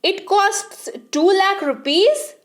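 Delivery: can't believe the cost; shocked. Shown as a demonstration. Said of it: "हे भगवान".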